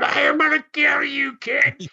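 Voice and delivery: high-pitched mock scream of a furious wrestler; retold screaming threat. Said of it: "And Royal starts screaming".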